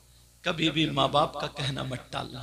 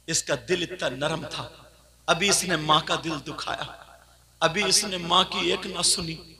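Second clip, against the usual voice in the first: normal talking voice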